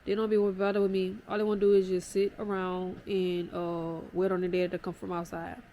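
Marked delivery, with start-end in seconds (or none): none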